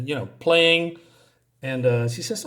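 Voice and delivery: ordinary delivery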